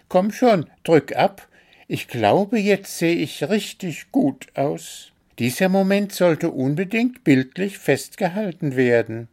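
high bird voice, urging; self-satisfied little bird. A narrator's reading. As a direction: push the photographer on, high and pleased with yourself. Story figure, little bird. (hohe, drängende Vogelstimme)

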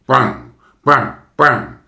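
loud, high banging cries; loud bangs. Acted. Crackers answer the fireball, loud gleeful bangs. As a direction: pop each bang like a cracker.

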